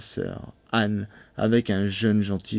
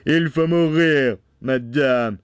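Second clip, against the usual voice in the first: loud, raised husband voice; stern Bluebeard; 4 semitones higher, 4 dB louder, slower than usual. A narrator's reading. Bluebeard condemning his wife, stern and loud. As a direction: pronounce the death sentence with force.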